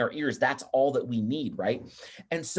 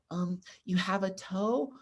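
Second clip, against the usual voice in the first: put-on timid kid's answer; 4 semitones higher, 5 dB quieter, slower than usual. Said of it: "Then one hand pops up".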